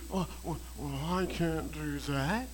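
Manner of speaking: a half-hearted imitation of doubt; mock sour whine. Preacher mocking a doubter, mock sour whine. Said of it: "that little sour".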